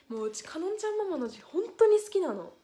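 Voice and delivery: usual speaking voice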